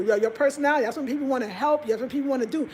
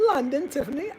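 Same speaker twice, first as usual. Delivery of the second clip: ordinary delivery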